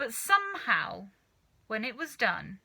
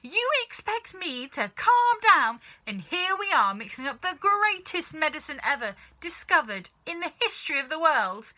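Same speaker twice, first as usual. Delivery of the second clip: indignant rant; 7 semitones higher, 7 dB louder than usual. Acted. Furious retort to his wife.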